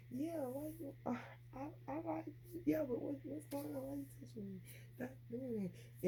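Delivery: put-on high, soft, sweet little-girl voice; coy and girly, brushing off a touch; cutesy baby voice. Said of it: "say it in a cute way".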